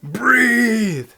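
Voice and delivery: high, loud drawn-out call; comic exclamation. Acted. Vlogger acting out the line envisioned in the script, comic loud call. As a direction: call the word out high and loud as a silly joke. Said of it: "I wrote this thing essentially envisioning".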